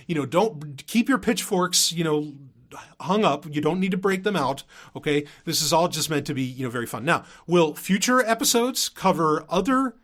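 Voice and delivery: usual speaking voice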